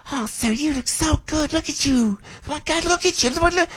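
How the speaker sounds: hammed-up high gush of praise; exaggerated gushing woman. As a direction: overdo the admiration like a starstruck fan. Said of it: "I'm with that exaggeration".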